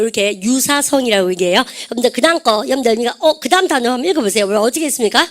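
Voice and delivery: ordinary talk, nothing marked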